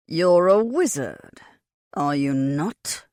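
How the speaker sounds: slow, heavy growl. A narrator's reading. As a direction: growl the accusation slowly. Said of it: "Oberon growled".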